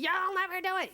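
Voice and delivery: high taunting liar; high mocking inner liar. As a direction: sneer it like the doubting liar. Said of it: "a liar in our head".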